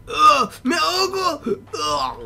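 high, loud gasping cries; drowning panic. Real, not acted. Gamer yells to viewers that he's drowning, panicked cries. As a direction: yelp in panic for air. Player drowning again in game.

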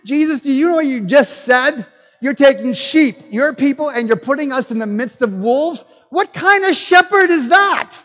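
incredulous protest. Retold or imitated. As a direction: protest in mounting disbelief. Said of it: "and said".

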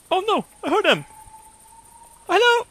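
very high, playful cry; excited squeal. Pretend. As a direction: call to the ducks in a squeaky falsetto. Calling out to ducks he hears.

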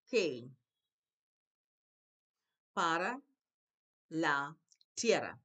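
loud, separated words with a pause after each; word-by-word reading. Shown as a demonstration. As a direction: model the Spanish one word at a time. Teacher reads Spanish word by word.

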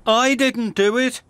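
high-pitched protest of innocence. A narrator's reading. Ned blamed for the slate, protesting. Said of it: "protested Ned".

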